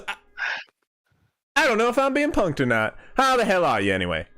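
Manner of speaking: high, hearty drawling hunter-woman voice; folksy frontier woman. Acted. GM voicing the lodge owner greeting the party, hearty high folksy voice. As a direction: greet the travelers like a friendly backwoods proprietor. Owner, suspicious, speaks quickly.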